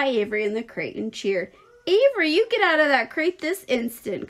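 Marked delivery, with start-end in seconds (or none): 1.9-4.3 raised, louder scolding order; commanding. A narrator's reading. Mother orders son out of crate, commanding. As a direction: lay down the law, pitched high. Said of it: "commanded his mother".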